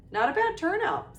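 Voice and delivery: a touch of puffed-up pride; proud. A narrator's reading. Ghost host admiring his party's crowd, proudly. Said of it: "said Nearly Headless Nick proudly".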